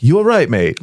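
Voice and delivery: mock Brit asking you all right, mate; British greeting.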